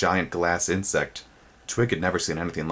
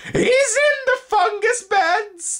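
very high, very slow, loud insect screech; 6 dB louder, much slower than usual. A narrator's reading. Spindlebug raising the alarm, high slow screech. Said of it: "the insect screeched".

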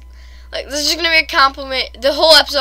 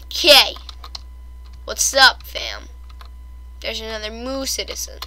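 ordinary talk, nothing marked

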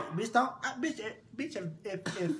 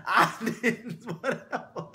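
laughing; 4 semitones higher, 6 dB louder, slower than usual. Real, not acted. Laughs while describing the mother.

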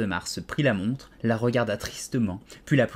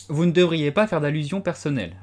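Alice scolds the Hatter severely; firm, severe reproach; stern; 7 semitones higher, 4 dB louder than usual. A narrator's reading. Alice reproves the Hatter, stern and raised. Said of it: "répliqua Alice sévèrement".

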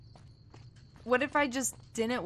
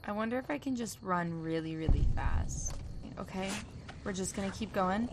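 normal talking voice

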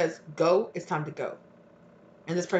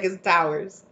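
ordinary talk

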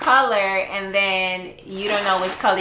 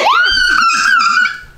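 loud shrieking laugh; 5 dB louder than usual. Real, not acted. Mom reacts to lucky pick.

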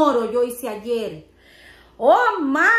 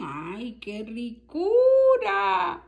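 1 delighted moan; 5 semitones higher, much slower than usual. Real, not acted. Savoring her food on camera.

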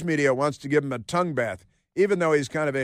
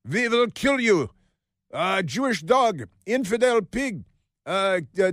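much higher, slowed mock-fanatical threat; mock menacing threat; 6 semitones higher than usual. Radio host mimicking Iranian missile messages, mock menacing. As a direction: put on a menacing caricature of a militant.

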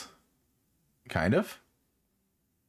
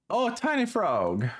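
excited. Real, not acted. Spots a tiny frog.

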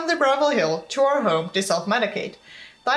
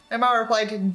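ordinary delivery, nothing marked

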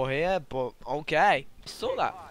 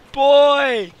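triumphant yell; 11 semitones higher, 15 dB louder than usual. Real, not acted. Player proud of a random grenade, triumphant yell. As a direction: crow it out loudly.

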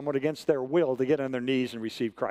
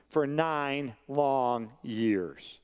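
"nine long years" very slow, each word stretched; "nine long years" spaced out with pauses between words; drawn-out emphasis; 2 semitones lower, much slower than usual. Retold or imitated. Retold sister stressing long wait.

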